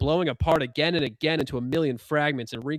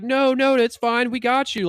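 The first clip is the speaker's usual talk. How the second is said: reassuring; 9 semitones higher, 5 dB louder than usual. Retold or imitated. Higher self reassuring nervous Bob.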